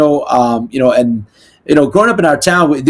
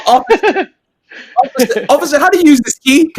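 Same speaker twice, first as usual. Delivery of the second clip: high, whiny mock plea to the officer; mock helpless Karen; 10 semitones higher than usual. Mocking a helpless Karen who can't work her key, higher pitched plea. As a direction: ham up a helpless lady crying for help.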